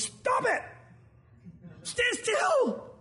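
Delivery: sharp high shout of command; commanding rebuke. Retold or imitated. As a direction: bark the order to freeze them. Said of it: "almost like Moses goes".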